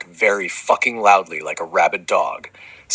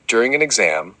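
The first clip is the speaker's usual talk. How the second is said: ordinary talk, nothing marked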